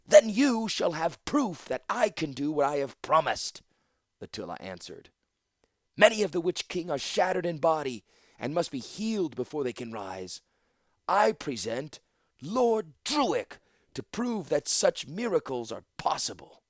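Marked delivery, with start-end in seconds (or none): 0.0-3.6 high, loud proclamation; ringing, forceful retort; defiant answer. A narrator's reading. Latilla answers the council's challenge. 6.0-16.6 high, forceful orator; grand, ringing announcement; public proclamation. A narrator's reading. Latilla presents her proof publicly.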